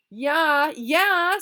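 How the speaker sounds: very high, loud jeer; sassy. Acted. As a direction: sass them from behind a tree. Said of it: "sassed Alan and Joe".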